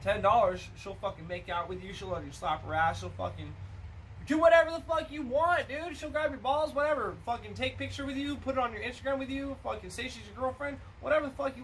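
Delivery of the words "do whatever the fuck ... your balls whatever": excited. Real, not acted. Excited retelling, high loud.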